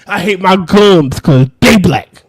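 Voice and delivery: shouted movie line, full volume; yelling quote. Retold or imitated. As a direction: yell it like an angry character. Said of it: "the guy was saying".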